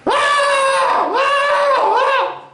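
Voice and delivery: very high shrieking "Ah!"; high-pitched kid scream. Retold or imitated. Kid Joe showing his friend how to scream high. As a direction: shriek way up high to show how it's done. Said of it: "this is how you do it".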